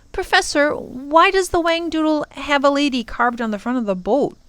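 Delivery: put-on high-pitched inquisitive child; curious child. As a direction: ask it high, like a curious youngster. Child questions the professor.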